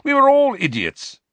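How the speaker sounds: high, loud exclamation; exuberant admission. A narrator's reading. Sirius admits they were all foolish, exuberantly. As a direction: burst out with it, voice shooting up.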